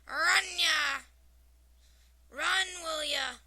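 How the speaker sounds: loud, high Scottish holler; thick Scottish accent roar. A narrator's reading. Scottish immigrant cheering at baseball, roaring in a Scottish accent. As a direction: roar it like a Scotsman at the ballpark. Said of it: "roars with the crowd in his thick accent".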